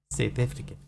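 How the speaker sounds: put-on South African pronunciation; South African accent. Shown as a demonstration. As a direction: quietly demonstrate South African vowels. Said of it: "they're very distinct".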